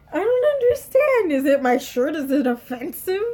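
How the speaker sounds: high, loud incredulous outburst; shocked disbelief. Retold or imitated. Student reeling from being called pathetic, shocked disbelief. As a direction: squeal in hammed-up bewilderment. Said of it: "I was in such shock".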